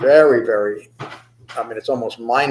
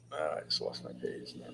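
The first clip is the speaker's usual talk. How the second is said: muttered aside; 7 semitones lower, 21 dB quieter than usual. Real, not acted. Aside while searching notes.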